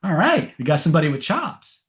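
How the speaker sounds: high, bright "oh, all right!" reaction; impressed surprise. Retold or imitated. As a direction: sound pleasantly caught off guard. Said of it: "I was like".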